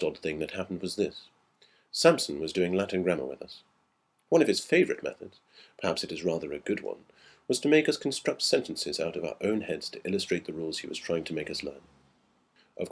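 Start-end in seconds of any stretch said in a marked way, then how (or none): none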